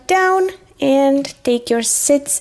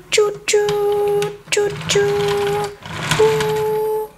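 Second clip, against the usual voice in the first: high, drawn-out choo-choo; train whistle; 5 semitones higher, much slower than usual. Pretend. Conductor playing choo-choo train for the viewers, whistle imitation. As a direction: imitate a train whistle on one held note. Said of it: "The choo-choo train is coming".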